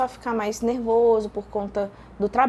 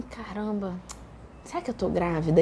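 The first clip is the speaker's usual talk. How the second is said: soft, lowered retold thought; hushed self-talk; 4 semitones lower, 6 dB quieter than usual. Woman asking herself if she is pregnant, hushed self-talk. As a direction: wonder it under your voice.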